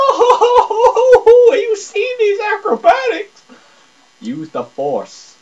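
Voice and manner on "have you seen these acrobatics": laughing. Real, not acted. Laughing gamer exclaims.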